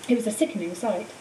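plain reading voice

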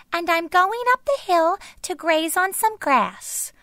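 very high little goat voice; tiny goat voice. A narrator's reading. Youngest goat answering the troll, tiny high voice. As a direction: squeak it like the tiniest goat. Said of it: "said the tiniest billy goat Gruff".

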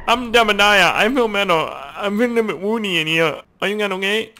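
high, silly garbled character voice; high-pitched mock-pilot gibberish; little pilot's babble. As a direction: babble like the little guy in the cockpit. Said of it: "Look at the guy in there".

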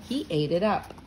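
usual speaking voice

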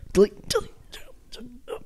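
hushed whisper, trailing away; secretive repeated whisper. Shown as a demonstration. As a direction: whisper the word over and over. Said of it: "why you whisper it".

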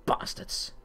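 whispered bastards; whispered curse. Real, not acted. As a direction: hiss it under the breath. Muttered curse at the game.

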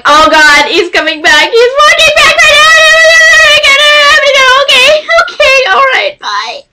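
very high, loud squealed hang-up; hammed-up fangirl panic; panicked excitement. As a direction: squeal in a frantic rush to get off the phone. Panicked hang-up as idol returns.

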